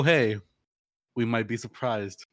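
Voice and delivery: normal talking voice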